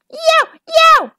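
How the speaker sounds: high, loud yelping howl; howl of pain. A narrator's reading. Ostrich howling in pain after the snake crushes her toes. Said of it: "Ostrich howled".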